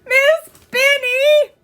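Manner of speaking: high, slow, loud tearful plea; near tears. Acted. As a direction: call the teacher almost crying. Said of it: "cried Susan, on the verge of tears".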